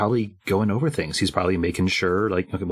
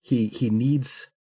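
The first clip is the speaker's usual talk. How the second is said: higher, halting appeal; anxious pleading; 6 semitones higher than usual. Acted. Scott backing away with hands up, anxious pleading. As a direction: plead carefully, a touch panicked.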